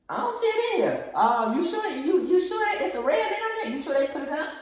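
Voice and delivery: very high, loud put-on puzzlement; feigned confusion. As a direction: play innocent in a squeaky high voice. Feigning a fruitless fridge search.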